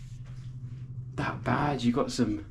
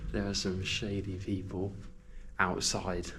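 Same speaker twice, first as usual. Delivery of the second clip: whispering; 2 semitones lower, 8 dB quieter than usual. Real, not acted. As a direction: keep it down so nobody outside hears. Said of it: "I don't know why I'm whispering".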